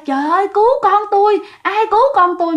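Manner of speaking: imitating a desperate mother shouting for help; frantic cry for help. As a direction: retell a mother screaming for someone to save her son. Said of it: "mẹ vừa chạy mà vừa khóc mà vừa la".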